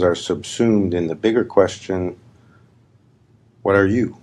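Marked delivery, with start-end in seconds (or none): none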